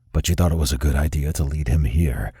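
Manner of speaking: low, cold reproach; scowling boss. A narrator's reading. Remington scowls at Alina for leading her father there, low and cold. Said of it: "Remington scowled at her".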